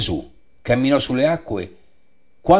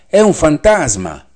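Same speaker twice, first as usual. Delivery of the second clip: louder, higher, slow frightened exclamation; frightened cry; 6 semitones higher, 6 dB louder, slower than usual. A narrator's reading. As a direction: cry out as if seeing a ghost. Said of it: "si misero a gridare dalla paura".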